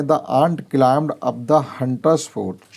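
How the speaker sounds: reads the English sentence very slowly, word by word; slow clear reading. Shown as a demonstration. Teacher reading an example sentence to pupils, very slow. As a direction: spell it out slowly for the class. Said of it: "वो क्या कह रहे हैं".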